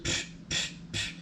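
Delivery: soft airy "psh" shots; three short whispery pops of air; imitated gun puff. Shown as a demonstration. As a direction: mimic quiet air-gun shots with puffs. Imitating the gun's soft shot.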